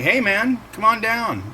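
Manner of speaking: coaxing friend. Retold or imitated. Friend's invitation voiced in story.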